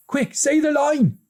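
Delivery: very high, hurried push; urgent. A narrator's reading. Older brother urges his brother on, high and hurried. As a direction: rush him to act. Kieran voiced.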